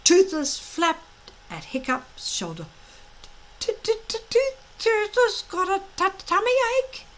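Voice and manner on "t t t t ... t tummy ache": high, whiny stammering dragon voice; complaining little dragon. A narrator's reading. Small dragon whining to Hiccup about a tummy ache. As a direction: whimper it like a sulking pet dragon. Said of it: "he complained".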